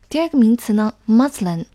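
normal talking voice

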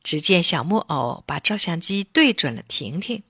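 plain reading voice

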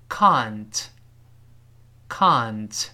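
can't with a long open ah, drawn out slowly; British can't. Shown as a demonstration. Teacher modelling British can't for learners, slow British ah. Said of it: "英国人读成".

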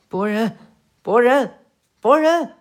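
calls building from normal to a high loud yell; shouting to wake him. Acted. Sarada shaking wounded Boruto awake, building shouted calls. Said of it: "佐良娜大喊道".

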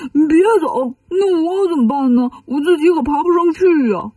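high, wailing plea; pleading call. A narrator's reading. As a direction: call after someone not to leave you stranded. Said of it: "八戒喊".

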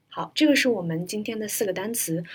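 ordinary talk, nothing marked

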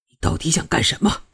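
low, contained exasperation; exasperated. A narrator's reading. Prince losing patience with Shuangyue after the slap, exasperated. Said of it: "凌风终于忍不住了".

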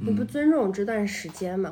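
normal talking voice

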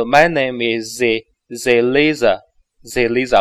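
slow "Zeliza" with the z linked on; linked z demo. Shown as a demonstration. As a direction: glue is onto Elizabeth as a z. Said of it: "Z和一就是Z". (慢读"Zeliza"连读)

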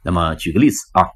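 normal talking voice

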